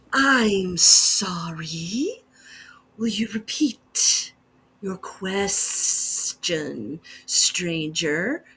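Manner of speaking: slow, breathy whispered insect voice, ending in a buzz; a graceful, menacing bee creature whispering slowly; menacing bee woman. Acted.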